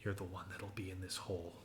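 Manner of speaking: soft, quick murmur in the ear; low-volume, confiding threat; menacing whisper. Acted. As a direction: lean in and say it hushed and fast. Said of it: "lean in and whisper in your ear".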